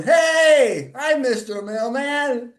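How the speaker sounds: put-on high, loud, cheery kid shout then slow hi; little boy's greeting. Three-year-old brother surprising the mailman, high excited shout. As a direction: pop up and yell hello like a delighted toddler. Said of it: "Out pops my brother Dan going".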